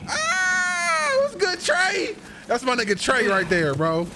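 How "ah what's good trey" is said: very high squeal of joy; excited greeting. Real, not acted.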